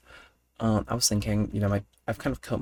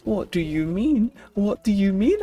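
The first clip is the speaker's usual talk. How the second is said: high, loud mock outrage, slowed right down; hammed-up cry of being wrongly accused; exasperated outburst; 12 semitones higher, 4 dB louder, slower than usual. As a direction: play up the indignant disbelief. Retold outburst at being framed.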